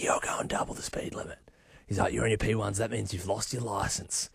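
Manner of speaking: mock officer voice, barely above a whisper; hushed cop quote. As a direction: drop to a breathy hush for the cop's lines. Cop's words retold in hushed voice.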